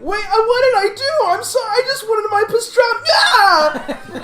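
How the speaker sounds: very high, pleading squeak; John begging over his pastrami; whiny John voice. Acted. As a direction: plead like a pathetic man-child. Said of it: "you hear John's voice saying".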